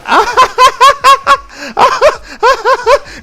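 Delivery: high, loud, shrieking laugh; helpless shrieking laughter; howling laugh. Retold or imitated. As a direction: burst out cackling. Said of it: "he just started laughing like".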